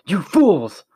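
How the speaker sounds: a furious, loud, high bellow; angry bellow. A narrator's reading. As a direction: roar it at the bungling guards. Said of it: "a loud and angry voice bellowed".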